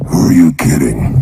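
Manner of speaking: very low, slow voice of doubt; doubting inner voice. Acted. Voice in your head mocking your big vow, doubting. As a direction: drop right down and drag it out sceptically. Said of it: "this voice in your se-, head goes".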